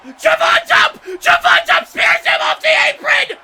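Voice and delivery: high screaming excitement; excited yelling. Real, not acted. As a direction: scream it out, thrilled. Commentator erupts at comeback.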